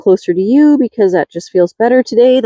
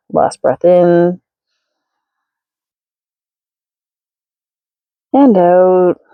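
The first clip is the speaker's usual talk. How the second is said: slow breathing cue; 2 semitones lower, slower than usual. Acted.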